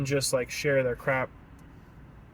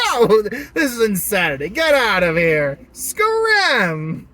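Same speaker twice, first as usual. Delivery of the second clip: hammed-up shout, climbing to a high cry; loud voice; 5 semitones higher, 7 dB louder, slower than usual. Man alone in car talking to himself, hammed-up loud shouting. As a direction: shout them away like a raving man. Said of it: "talking to himself in a loud voice".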